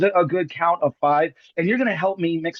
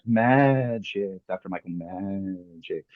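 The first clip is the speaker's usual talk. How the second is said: slow, drawn-out magician's flourish, sinking lower; mock mystical; 8 semitones lower, 4 dB quieter, much slower than usual. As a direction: stretch MAGIC out and let it fade.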